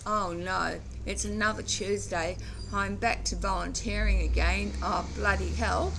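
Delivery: heavy-hearted, fed-up complaint, put on; mock dread. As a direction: act out dreading the weekly chore. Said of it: "like, a bad thing".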